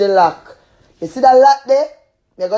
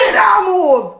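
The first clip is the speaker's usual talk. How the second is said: shouting; 9 semitones higher, slower than usual. Real, not acted. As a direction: yell your refusal to move. Tenant refuses, shouting.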